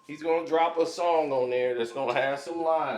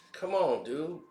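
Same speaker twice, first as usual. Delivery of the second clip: ordinary delivery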